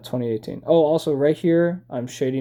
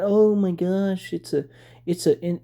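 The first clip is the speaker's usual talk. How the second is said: mocking imitation; 5 semitones higher than usual. Ranting gamer parodies fans defending the hipster Odin, mocking. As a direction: imitate a gushing fan, stretched out.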